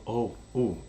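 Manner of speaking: ordinary talk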